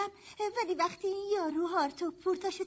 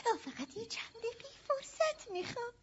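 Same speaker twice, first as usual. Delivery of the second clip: tearful plea; 3 semitones higher, 10 dB quieter than usual. Acted. Pleading to persuade her sister.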